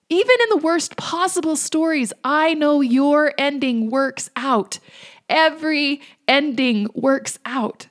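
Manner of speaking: high, loud, building conviction; rising preaching. Real, not acted. Speaker assuring listeners their story ends well, rousing preaching. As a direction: lift them up like a preacher.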